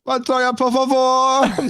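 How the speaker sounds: very high, drawn-out melodramatic begging; mock desperate plea. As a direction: imitate an overwrought Spanish plea. Mimicking a viral clip's plea.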